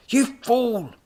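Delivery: high, loud angry scream; furious scream. A narrator's reading. Professor crashed into by robot, furious scream. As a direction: scream the insult at full pitch. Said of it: "he screamed".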